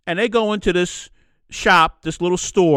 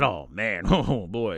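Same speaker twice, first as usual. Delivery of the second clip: much lower and softer, half laughing through emotion; choked up; 9 semitones lower, 6 dB quieter, slower than usual. Retold or imitated. Host recalling being moved by the shopkeeper, choked up. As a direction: let it catch in the throat with a small laugh. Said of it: "that kinda made me get choked up".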